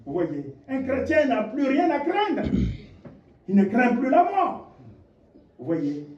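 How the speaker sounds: ordinary talk, nothing marked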